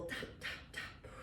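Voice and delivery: breathy whispered dop, dop, dop; barely a breath on each dop. Shown as a demonstration.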